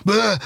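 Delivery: high silly retching bleh; comic vomit noise; mock bee retch. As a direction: spit it up like a bee.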